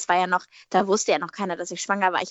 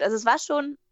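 usual speaking voice

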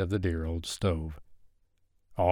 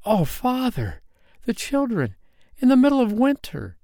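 high-pitched appeal, building louder; distressed cry; 4 dB louder than usual. A narrator's reading. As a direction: cry out in alarm for the children. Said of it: "Hilda gave a cry".

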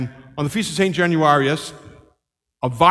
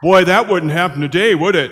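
louder, incredulous outburst; loud exclamation; 2 semitones higher, 7 dB louder than usual. Retold or imitated. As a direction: blurt it out in disbelief. Friend exclaiming at the story.

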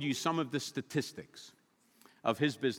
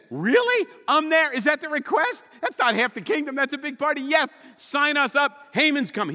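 much higher, loud delighted yes; eager king; 10 semitones higher, 6 dB louder than usual. Acted. King Xerxes accepting Esther's party invite, thrilled.

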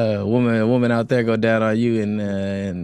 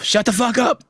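shouting; 12 semitones higher than usual. Pretend. Sudden outburst, Tourette's joke.

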